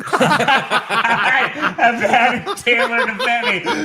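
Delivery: high, loud, breaking up with laughter; laughing confession. Real, not acted. Guest admits tailoring a borrowed jacket, laughing hard. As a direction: blurt it out through real laughter.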